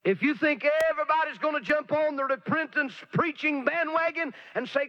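usual speaking voice

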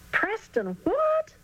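high, slow, stunned echo of the name; boy baffled by his new friend's long name, high and slow; surprised. Acted. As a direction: gulp and ask in disbelief, drawn out. Charlie gulps at long name.

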